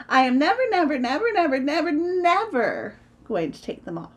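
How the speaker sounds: high, loud run of "never"; emphatic childish defiance; stubborn refusal. Acted. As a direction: dig in, piling up each "never". Tina stubbornly refuses, repeating never.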